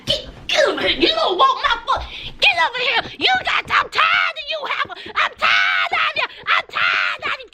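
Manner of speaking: high hammed-up yelling, laughing through it; mock fury. Vlogger pretends to go after Destiny, hammed-up shouting. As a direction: pretend to chase her down, shouting.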